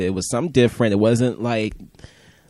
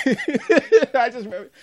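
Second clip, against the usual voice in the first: ordinary talk, nothing marked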